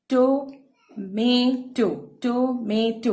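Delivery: "टोमेटो" in three parts, टो-मे-टो; syllable break. Shown as a demonstration. Teacher shows the break here too, "टोमेटो" syllable by syllable. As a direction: say "टोमेटो" one syllable at a time. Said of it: "यहाँ पे भी syllable break हो रहा है".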